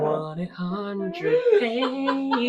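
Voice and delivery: drawn out through laughter; laughing. Real, not acted. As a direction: stretch it out, giggling. Repeating phrase while laughing.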